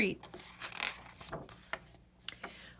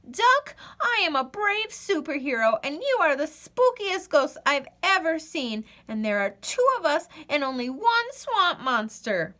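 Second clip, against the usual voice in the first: lightly voiced brave Goose; 6 semitones higher than usual. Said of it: "Goose whispered".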